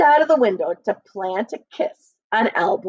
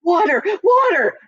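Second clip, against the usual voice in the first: high, loud cry for water; yelling in pain; 8 semitones higher, 4 dB louder than usual. A narrator's reading. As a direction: shout out in burning pain. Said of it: "Nicholas's voice yelling".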